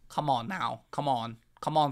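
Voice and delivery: ordinary talk, nothing marked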